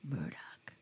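low, whispered action-hero menace; Rambo. Retold or imitated. Plays Rambo at the microphone.

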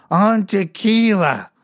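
put-on loud, high, drawn-out drunken boast; drunk woman. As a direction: blurt it slowly like a hammed-up drunk. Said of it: "I immediately knew she was drunk".

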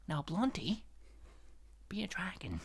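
calm, quiet and precise; very soft, carefully measured mock-calm impression; Jerry Stiller. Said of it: "talking very calmly and quietly, being really precise".

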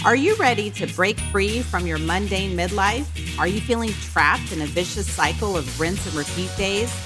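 upbeat host intro read; podcast intro. Acted. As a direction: read it like a show opener. Podcast intro read over music.

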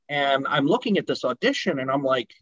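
ordinary talk, nothing marked

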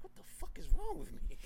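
high, loud cry of despair; crying out in disbelief. Retold or imitated. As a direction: wail it out, baffled at yourself. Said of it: "Then I started crying when I was running".

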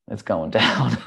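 a laugh breaking into the words. Retold or imitated. Dad's look as the nurse refuses, laughing. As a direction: laugh as you say it's going down.

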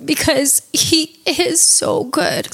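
slow, heartfelt and earnest; fervent praise. Real, not acted. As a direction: savour each word of praise.

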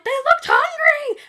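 high, loud imitated shriek; mock yell. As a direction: shriek it like a frantic reality star. Lisa yelling on the boat.

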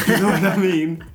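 loud laughing question. Real, not acted. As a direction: burst out laughing, high and loud. Laughing after his joke.

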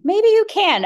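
"Maybe you can" thrown up high and loud, mocking; mocking the trainer's distant view, sarcastic. Shown as a demonstration.

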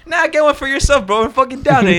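put-on high, pushy drunk coworker voice; coworker Isaac. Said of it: "he's like".

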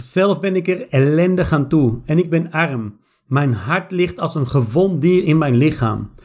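ordinary talk, nothing marked

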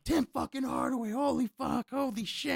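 high-pitched mock yelling fan; retold yell. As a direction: imitate a starstruck friend squealing. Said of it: "he's yelling".

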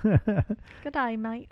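playful stab at an Australian greeting; attempted Australian accent. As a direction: try a jokey Australian accent. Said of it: "um, Australian".

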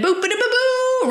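high-pitched "boop ba-da-ba-boo"; sung mock fanfare. Host heralding the redcoats' arrival, high mock fanfare. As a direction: toot a little arrival fanfare by mouth.

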